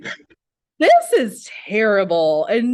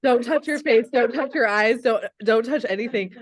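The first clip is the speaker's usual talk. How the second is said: ordinary talk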